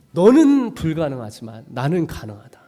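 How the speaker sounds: normal talking voice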